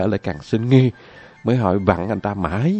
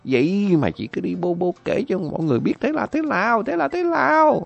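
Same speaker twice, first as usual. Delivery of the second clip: much higher hammed-up mock tirade; scolding tirade; 12 semitones higher than usual. Dần berates the other woman, high hammed-up scolding. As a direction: scold her without pause. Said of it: "mắng té tát".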